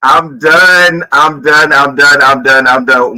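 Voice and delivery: loud, laughing it out, repeating helplessly; overwhelmed with laughter, crying out I'm done; host cracking up after the rant clip, loud and laughing; laughing fit. Real, not acted. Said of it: "I cackled".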